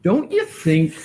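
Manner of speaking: usual speaking voice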